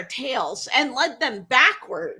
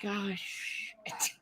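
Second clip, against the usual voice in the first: muttered dismay; 4 semitones lower, 14 dB quieter than usual. Retold or imitated. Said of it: "And Zeus is like".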